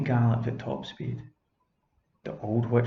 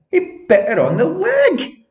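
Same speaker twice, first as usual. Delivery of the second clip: excited climax; 11 dB louder than usual. A narrator's reading. Narrator's climactic moment.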